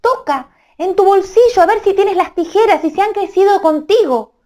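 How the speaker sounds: very high, small little-man voice; tiny voice. Acted. Tiny Amadís comforting crying Melisenda from her ear: very high squeak.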